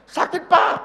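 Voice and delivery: high, loud retold cry of hurt; pained outcry. Minister telling senior pastor how it hurt, pained outcry.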